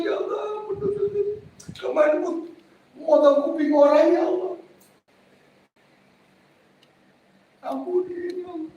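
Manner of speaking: very high, wailing mock sob; imitated tearful praying. Repentant ear-cutter begging God at umrah, imitated crying. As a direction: plead to God in a high, put-on weeping voice. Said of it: "dia nangis kalau umrah nangis".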